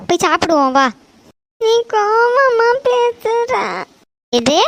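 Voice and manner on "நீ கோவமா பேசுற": put-on high, slow tearful child's wail; crying. Child accuses mother of speaking angrily, crying. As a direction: complain through sobs, stretching each word. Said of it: "எதுக்கு அழுறா?".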